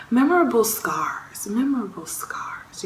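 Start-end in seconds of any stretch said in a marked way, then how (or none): 1.5-2.8 soft, half-whispered repeat; said under the breath; whispered echo. Real, not acted. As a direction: drop it to a hushed echo. Same words repeated under her breath.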